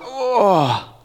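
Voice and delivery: long drawn-out waking groan; stretching groan. Pretend. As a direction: groan through a lazy stretch. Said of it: "quick stretch, rise up".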